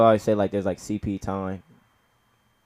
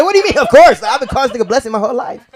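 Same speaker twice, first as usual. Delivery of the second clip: high, loud, then rushed laughing disbelief; outraged disbelief; 14 semitones higher, 10 dB louder, faster than usual. Retold or imitated. Son arguing with mom over cousin's real name. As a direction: protest like it's absurd.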